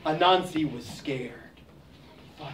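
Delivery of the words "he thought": whispered. A narrator's reading. Anansi's scared thought.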